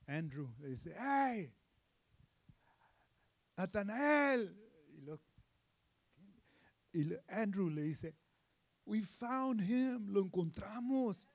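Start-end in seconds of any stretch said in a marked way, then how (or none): 1.0-4.6 high, drawn-out call across a distance. Acted. Andrew calling out to Natanael. 8.8-11.3 higher, slow, thrilled news; excited announcement. Acted.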